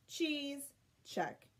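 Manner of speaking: ordinary delivery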